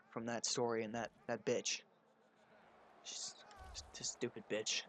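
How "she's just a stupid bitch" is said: a soft, unvoiced, slow aside; whispered insult. Real, not acted. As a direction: whisper the jab under your breath.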